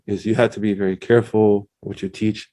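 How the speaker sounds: ordinary talk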